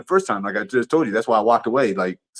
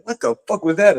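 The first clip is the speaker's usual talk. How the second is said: much higher, startled outburst; shocked what-was-that; 8 semitones higher than usual. Retold or imitated. Telling his homeboy after the bottle hits him, startled and higher. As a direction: blurt it out stunned. Retelling being hit by bottle.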